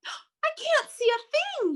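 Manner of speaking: high, alarmed little bird cry; panicked yell. Acted. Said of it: "yelled Pip".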